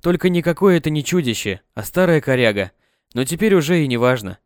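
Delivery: plain reading voice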